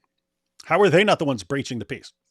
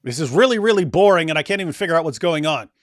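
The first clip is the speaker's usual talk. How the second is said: louder retold student protest; retold loud complaint; 3 semitones higher, 7 dB louder than usual. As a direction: complain loudly as a bored student. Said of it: "and I'm like".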